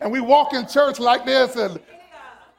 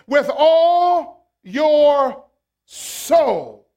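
"all" raised and louder, words stretched very slow; slow emphasis; 4 semitones higher, 7 dB louder, much slower than usual. Shown as a demonstration. Preacher reading the verse, stretching "all" slowly. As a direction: drag it out, punching "all".

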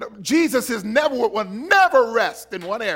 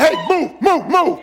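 louder, higher urging move; urgent call; 4 semitones higher, 3 dB louder than usual. Pretend. Pastor voicing an eager worshipper pushing forward. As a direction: shove your way to worship. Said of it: "you need to be like".

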